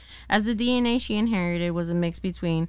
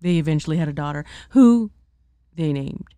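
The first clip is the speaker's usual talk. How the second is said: commercial voice; 4 dB louder than usual. Pretend. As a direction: sell it like a TV ad. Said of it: "I'm trying to do my commercial voice".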